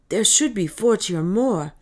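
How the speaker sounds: loud, eager freed man. Acted. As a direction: blurt it out eagerly. Peter antwortet dem Zwerg.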